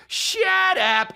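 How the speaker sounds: very high hammy shut up; exaggerated inside-joke impression. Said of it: "it became an inside joke".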